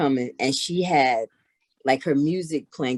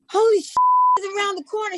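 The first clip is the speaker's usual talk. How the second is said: high, breathless excitement; excited high voice; faster than usual. Retold or imitated. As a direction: squeal it out like a thrilled fan. Retelling fan's excited rush.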